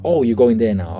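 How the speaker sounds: voiced double-take, playfully; imitated taken-aback reaction. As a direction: react as if the jab just landed. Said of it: "It's like".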